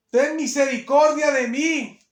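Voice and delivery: slow, high, loud plea; acted desperate begging; begging loudly. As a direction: cry out for mercy, stretching the words. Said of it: "va rogándole así".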